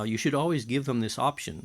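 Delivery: normal talking voice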